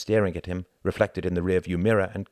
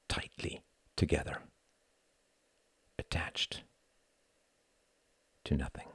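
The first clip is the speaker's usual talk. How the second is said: hushed narration; 4 dB quieter than usual. A narrator's reading. Narrator closing the chapter, hushed and fading. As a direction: let it fade into silence.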